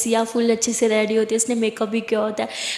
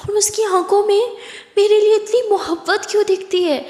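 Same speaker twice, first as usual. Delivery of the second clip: Siya's wondering inner voice; 8 semitones higher than usual. A narrator's reading. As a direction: ask yourself in a raised, wondering voice. Siya questioning herself inwardly.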